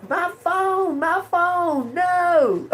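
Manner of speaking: high, hammed-up wail over a broken phone; mock wail. Vlogger mock-cries to viewers about her broken phone, hammed-up wail. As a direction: whine like the world ended, laughing through it.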